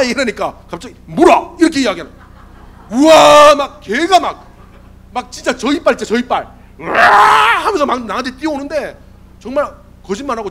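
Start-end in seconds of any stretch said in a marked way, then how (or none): 1.1-1.6 attack command. Retold or imitated. Friend commands army dog to bite. 2.9-3.5 loud, drawn-out "whoa"; big burst of sound for the charging dog; onrushing roar. Retold or imitated. Imitates dog charging at him. 6.8-7.7 high, loud rasping roar; imitated snarling dog charge; attack dog roar.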